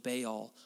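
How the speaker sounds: "Baal" said as BAY-ul; mistaken Anglicized pronunciation. Real, not acted. Preacher slips into the habitual name, BAY-ul pronunciation of "Baal". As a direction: say "Baal" like "bail". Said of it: "I even said it wrong".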